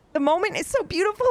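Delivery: high, emotional voice breaking into a laugh; choked up but laughing at yourself; getting emotional. Real, not acted. Reader moved retelling a beautiful scene, getting emotional. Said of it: "I'm getting emotional".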